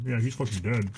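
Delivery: ordinary delivery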